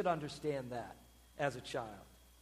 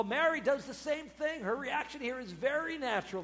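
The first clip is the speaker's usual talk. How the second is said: normal talking voice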